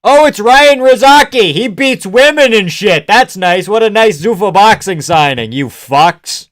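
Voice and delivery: loud, high sarcastic heckling; sarcastic yell. Real, not acted. Mocking the broadcast for showing him, loud sarcastic yell. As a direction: shout the fake praise with scorn.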